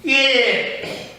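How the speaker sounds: high called-out mock holler; retold holler. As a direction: call it out sharply up the track, retold. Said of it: "I heard him holler".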